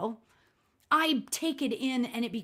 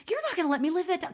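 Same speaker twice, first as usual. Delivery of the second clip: mock yell; 8 semitones higher, much faster than usual. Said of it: "So I'm screaming, not screaming".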